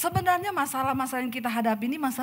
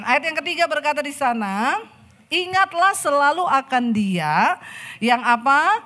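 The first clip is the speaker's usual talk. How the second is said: louder, higher, calling out to the crowd; full-force preaching; 5 semitones higher, 7 dB louder than usual. Acted. Preacher calling the congregation to answer, loud and high.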